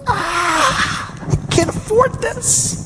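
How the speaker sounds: high, strained mock panic over money; panicked groan. Father of four voicing his money panic, high and strained. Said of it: "And I'm going".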